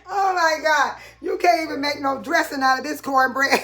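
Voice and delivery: carried-away laughing outrage; excited disbelief. Real, not acted. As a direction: exclaim in amused disbelief. Still laughing at bread.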